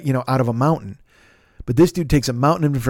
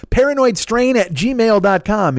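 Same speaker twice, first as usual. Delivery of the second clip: "Paranoidstrain at gmail dot com" repeated higher and slow; higher, emphatic repeat; 7 semitones higher, slower than usual. Shown as a demonstration.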